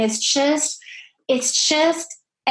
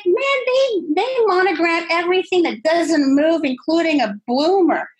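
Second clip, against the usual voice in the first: excited retelling; 6 semitones higher, 4 dB louder than usual. Retold or imitated. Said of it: "And I'm like".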